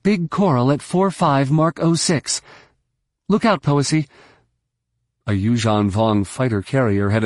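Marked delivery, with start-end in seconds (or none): 0.0-4.1 urgent call over the radio; calling over comm. Acted. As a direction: call the warning out sharply. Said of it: "she called".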